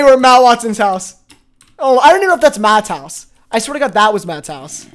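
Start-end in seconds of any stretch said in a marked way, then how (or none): none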